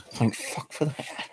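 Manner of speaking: slow, soft, breathless relief; relieved mutter. Real, not acted. Cat jumps off, man mutters his relief softly and slowly. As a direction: let it out under your breath.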